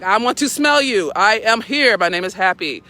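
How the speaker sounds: loud, proud dog voice for Happy; voicing the dog. Owner voices her dog Happy meeting another dog: loud proud voice. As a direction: speak for the dog sizing up a stranger. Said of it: "He's like".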